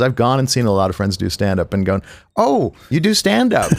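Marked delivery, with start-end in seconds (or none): 2.4-3.7 mock surprise. Mock reaction to friends' sets.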